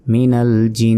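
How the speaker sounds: মিনাল, then জিন with its nasal n held long; ghunna on জিন. Shown as a demonstration. Teacher demonstrating the obligatory ghunna, long nasal n in জিন. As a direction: draw out the humming n that ends জিন. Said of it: "ওয়াজিব গুণনা হয়".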